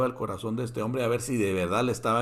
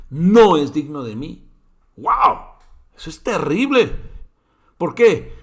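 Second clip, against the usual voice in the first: loud preaching; 4 semitones higher, 11 dB louder than usual. Real, not acted. Preacher's exclamation on scripture.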